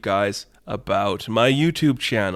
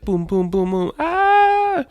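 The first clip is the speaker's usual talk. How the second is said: high squeaky minion booms ending in a squeal; minion noises; 3 dB louder, much slower than usual. Acted. Damien stomping toward Hopper, voiced as squeaky minion booms. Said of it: "He's like".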